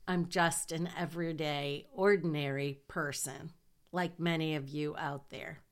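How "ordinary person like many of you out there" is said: normal talking voice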